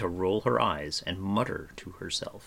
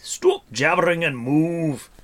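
loud, high, drawn-out angry order; slow, forceful shout of exasperation; furious sputter; 10 semitones higher, 10 dB louder than usual. A narrator's reading. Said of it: "he sputtered".

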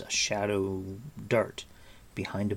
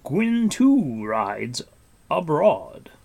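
slow, drawn-out sonorous musing; musing Gwydion; 6 semitones higher, 7 dB louder, much slower than usual. A narrator's reading. Gwydion realizing the Hunter rides, musing aloud. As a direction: intone it slowly and loudly. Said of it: "he mused".

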